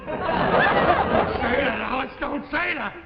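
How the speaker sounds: high, drawn-out loud cry; agitated outburst. Acted. Husband hears he sounds crazy, agitated shout. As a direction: wail the order, then repeat it sharply. Told he is out of mind.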